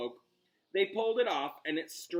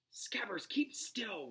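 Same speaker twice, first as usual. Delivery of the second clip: unvoiced hiss, higher; 7 semitones higher, 4 dB quieter, slower than usual. Ron hisses at his rat to keep still. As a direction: hiss it tight and secret. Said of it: "Ron hissed".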